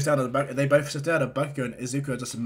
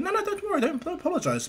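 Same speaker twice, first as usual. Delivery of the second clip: much higher voice at usual volume; Izuku's flustered reassurance. Acted. Boy tells the girl not to apologize, flustered. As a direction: wave off the apology in a high flutter.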